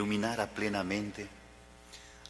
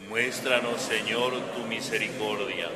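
congregation response; 2 semitones higher, 5 dB louder than usual. Real, not acted. Assembly answers the prayer together.

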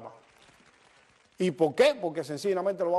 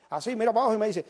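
usual speaking voice

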